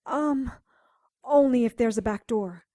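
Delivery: ordinary talk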